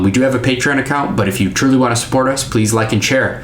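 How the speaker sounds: fast, loud podcast outro. Acted. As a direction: rattle off the sign-off upbeat.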